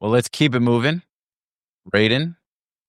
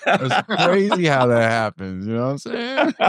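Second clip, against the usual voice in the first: high, slow words broken by real laughter; can barely get it out for laughing; 11 semitones higher, much slower than usual. Real, not acted. Laughing after muting friend.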